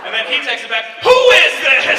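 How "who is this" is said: loud, high-pitched startled question; raised, indignant comic outburst; alarmed demand. Retold or imitated. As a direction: demand it in mock bewilderment. Acts out the baffled texter's reply.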